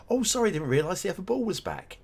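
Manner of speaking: a footballer's fake innocent apology; mock excuse. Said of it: "Something like that".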